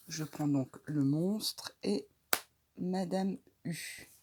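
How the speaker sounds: normal talking voice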